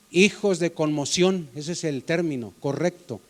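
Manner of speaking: ordinary talk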